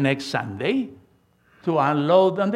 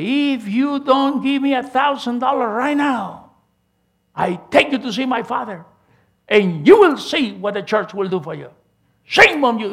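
put-on higher, louder scolding of a young cousin; retold angry scolding; 6 semitones higher, 5 dB louder than usual. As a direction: play the cousin who is furious and demanding. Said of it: "Then he get mad at me".

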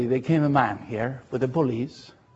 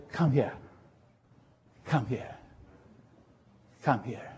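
softer each time, fading to hush; mother-in-law softly welcoming him home; tender call; 10 dB quieter than usual. Retold or imitated. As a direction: coax him gently closer. Mama calling him tenderly.

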